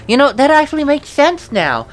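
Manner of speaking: usual speaking voice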